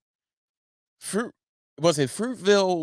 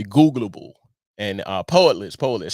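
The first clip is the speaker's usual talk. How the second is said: ordinary talk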